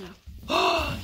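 high surprised gasp; delighted gasp. Real, not acted. Mom gasping at her son's right guess.

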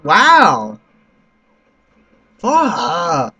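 loud angry outburst; frustrated yell. Real, not acted. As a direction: blurt it out as you die. Gets killed, yells.

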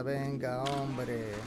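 ordinary talk, nothing marked

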